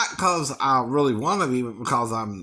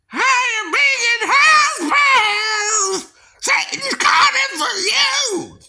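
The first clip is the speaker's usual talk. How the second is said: screamed much higher and louder rock wail; 8 dB louder than usual. Retold or imitated. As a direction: screech it like a hard rock frontman. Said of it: "That's what [name] sounds like".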